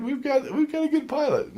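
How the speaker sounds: high, eager we've-got-a-good-pilot; relieved passenger. Retold or imitated. Passenger reassured by the pilot, high and relieved. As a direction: naive relief. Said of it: "You're like".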